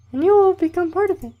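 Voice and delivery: loud, squeaky falsetto camp leader giving a speech; hammed-up mock female falsetto; bad girl voice. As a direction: lecture in a badly faked, very high female voice. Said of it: "That was a really bad girl voice".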